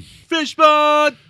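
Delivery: squeaky high silly voice; goofy high-pitched echo; silly clown. Acted. Echoing the joke in silly voice.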